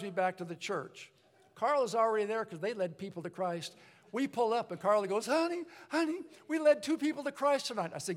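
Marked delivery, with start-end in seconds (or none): 5.2-7.9 much higher, eager voice; a giddy, hammed-up cheer; gushing excitement. Carl greeting his wife after soul winning, gushing.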